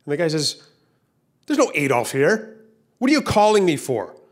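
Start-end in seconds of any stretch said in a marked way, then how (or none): 1.5-4.2 irritated snap, rising higher and louder; bad-tempered, mounting annoyance; annoyed. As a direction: act out a short-tempered man on the phone. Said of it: "No, that's annoyance".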